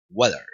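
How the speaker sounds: low "Weather" opening like Spanish "huevo". Shown as a demonstration. Teacher modelling the W sound, like huevo. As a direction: round the W of "Weather", weak final vowel. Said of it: "Weather suena similar a huevo".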